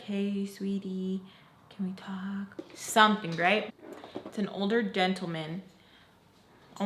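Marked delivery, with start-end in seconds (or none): 0.0-2.6 soft, tender imagined approach; gentle coaxing. Retold or imitated. Mister Peach wants to talk to her, soft and coaxing. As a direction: coax softly, trailing quieter.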